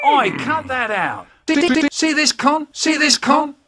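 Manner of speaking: high, loud angry shout; yelled scolding. Acted. Shouts at someone to stop, loud and high. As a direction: bellow it across the room.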